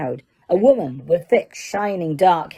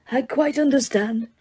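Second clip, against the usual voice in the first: soft, higher, gentle; kind reassurance; 6 semitones higher, 3 dB quieter than usual. Acted. Dumbledore reassuring Harry, calm. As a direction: set him at ease.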